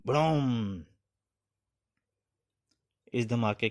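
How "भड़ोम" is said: long vocal "bhadom" boom; imitated explosion. A narrator's reading. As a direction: voice a huge blast, letting it trail away. Narrator voices the explosion.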